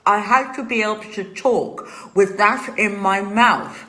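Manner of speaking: ordinary delivery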